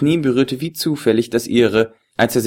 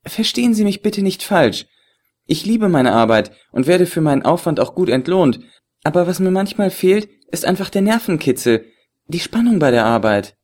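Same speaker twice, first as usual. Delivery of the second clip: higher, teasing feminine voice; woman's voice; 8 semitones higher than usual. Acted. The beauty teases the agent about his phone call, playfully higher.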